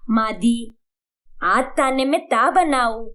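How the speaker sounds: मादी and ताने lisped for माँजी and खाने; lisped question. Acted. Daughter-in-law asking mother-in-law about cooking, lisped. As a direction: ask it sweetly with a child's lisp. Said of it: "अरे तोतली चुड़ैल".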